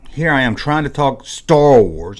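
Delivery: ordinary talk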